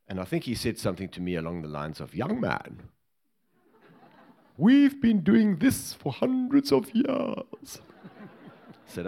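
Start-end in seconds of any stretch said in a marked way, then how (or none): 2.1-7.8 imitating a haughty old colonel, much higher and very slow; lofty lieutenant colonel lecturing a young bodyguard; stuffy old colonel. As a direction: look down your nose at the young man, drawing it out. Retold pompous retired colonel's reply.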